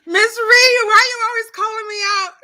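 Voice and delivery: high, loud mock complaint; playful protest. Real, not acted. Host answering a viewer who teases her, playful protest. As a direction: wail it quickly as a teasing grievance.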